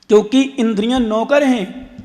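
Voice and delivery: ordinary talk, nothing marked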